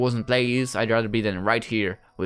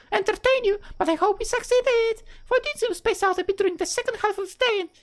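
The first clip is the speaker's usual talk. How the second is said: put-on high-pitched girl voice, much higher than his own; Rebecca's voice. Rebecca greets Arthur, high-pitched girl voice. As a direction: play a cheerful young girl in a high falsetto.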